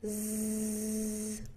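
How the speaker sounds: long voiced buzzing z; isolated z buzz, voice on; buzzing z sound. Shown as a demonstration. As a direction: hold a steady buzzing z on its own. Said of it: "definitely feel a buzz".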